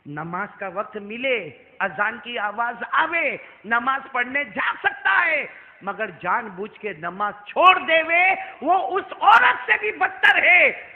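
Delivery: slow sermon delivery swelling to shouts; drawn-out, forceful preacher's warning; emphatic preaching. Real, not acted. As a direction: hammer each phrase home, loud at the ends.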